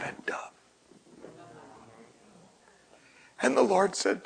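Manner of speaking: hushed preaching. Acted. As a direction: lean in and whisper the message. Hushed whispered preaching about dove.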